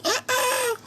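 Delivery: squealed "ah, ah" in disbelief; high disbelief. Real, not acted. Mock wail at the news.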